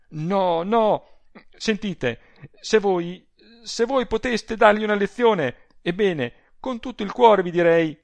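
high, loud, impassioned urging; agitated urging. A narrator's reading. Adele urges Rossani to teach the coward, agitated. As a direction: urge him on breathlessly.

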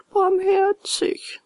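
high, very drawn-out lament; piteous high whine; wailing echo. A narrator's reading. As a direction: echo it as a slow howl. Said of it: "So heulten die zwei Räuber".

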